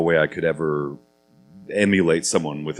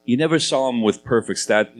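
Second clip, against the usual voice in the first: ordinary talk, nothing marked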